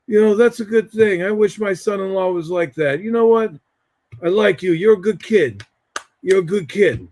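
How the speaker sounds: lightly voiced approving uncle; Uncle Freddie. Uncle praising the young man at the reception, lightly imitated voice. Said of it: "this is how he talked".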